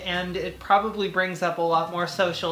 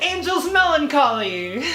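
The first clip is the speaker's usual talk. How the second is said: hyped title shout; 7 semitones higher, 4 dB louder than usual. Acted. Exclaimed title.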